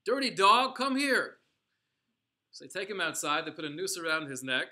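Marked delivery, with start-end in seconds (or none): 0.1-1.4 loud, high, furious shout; brutal guard's shout. Retold or imitated. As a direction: bark it like a cruel guard catching him. SS officer barking at prisoner.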